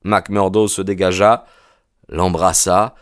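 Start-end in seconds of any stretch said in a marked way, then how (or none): none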